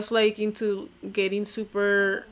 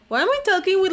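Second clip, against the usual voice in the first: exasperated; 10 semitones higher, 7 dB louder, much faster than usual. Real, not acted. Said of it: "vocal fry this whole time".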